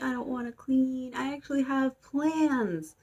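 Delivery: high, drawn-out complaint; mock whining. Girl scrubbing the bathroom floor complains, hammed-up whine. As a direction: whine like someone stuck with chores.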